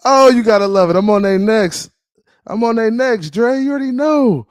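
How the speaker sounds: high, loud crowing; gloating hype. Real, not acted. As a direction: crow over your rivals with the pitch held way up. Gloating about needling rival fans.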